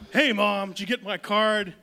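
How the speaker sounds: loud, cheery call to Mom; hearty greeting. Acted. Unabomber son greeting Mom for Mother's Day, hearty. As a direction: belt out a chipper holiday greeting.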